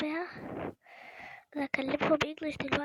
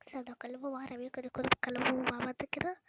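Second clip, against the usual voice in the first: ordinary talk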